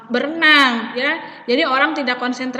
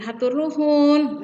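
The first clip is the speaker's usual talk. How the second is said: slower, respectful thank-you while bowing; deferential, higher and unhurried; polite humble thanks; 4 semitones higher, slower than usual. Shown as a demonstration. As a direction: say hatur nuhun slowly with a slight bow. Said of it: "bahunya itu agak di kebawahkan sedikit".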